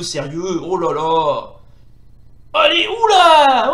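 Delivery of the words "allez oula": loud, high, slow drawn-out cheer; stretched excited gamer cry; excited whoop. Real, not acted. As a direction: whoop at a close exchange. Cheering during fight.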